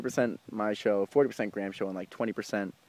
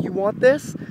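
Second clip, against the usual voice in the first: guitarist calling out; 9 semitones higher, 4 dB louder, slower than usual. Retold or imitated. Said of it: "he's like".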